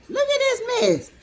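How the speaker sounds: giddy high-pitched exclamation; delighted squeal. Real, not acted. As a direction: tease about the mess with delight. Showing off her hair.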